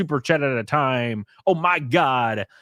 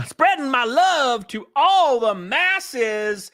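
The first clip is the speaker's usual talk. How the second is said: hammed-up announcer; 11 semitones higher, much slower than usual. Hyped shoutout voice.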